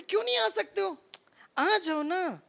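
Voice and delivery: high pleading coax; coaxing plea. Retold or imitated. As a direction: coax him in, pitched well up. Coaxing the man inside.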